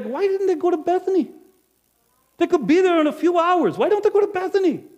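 loud, high, exasperated complaint. Acted. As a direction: vent in frustration. Innkeeper frustrated at the couple.

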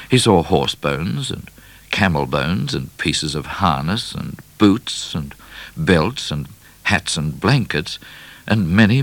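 usual speaking voice

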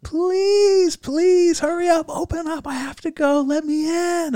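high, pleading desperate voice. Retold or imitated. Man begging at the bathroom door, desperate high plea. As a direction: beg to be let in, voice straining high.

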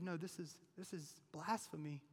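soft, dismissive judgment; quiet, firm rejection; hushed verdict. Acted. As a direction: murmur the condemnation. Scribes concluding.

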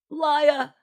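high-pitched angry shout; shouted accusation. A narrator's reading. Genevieve confronts Francois, shouting in accusation. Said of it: "Genevieve shouted".

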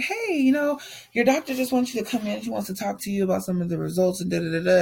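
high-pitched, quick phone greeting; chirpy assistant. Retold or imitated. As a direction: imitate a breezy assistant, starting high and rattling on. Quoting the doctor's assistant on phone.

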